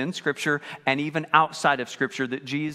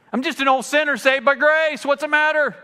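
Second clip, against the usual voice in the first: higher, louder mock excuse; a hammed-up excuse-maker, higher and louder; mock old sinner; 9 semitones higher, 7 dB louder than usual. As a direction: shrug it off as a smug old sinner. Mocking an excuse.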